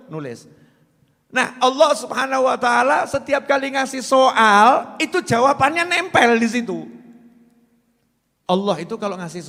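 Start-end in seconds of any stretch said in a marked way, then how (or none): 1.3-6.9 raised, higher preacher's voice; forceful preaching. Acted. Preacher proclaiming God's answers to the congregation, loud. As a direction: drive the point home loudly.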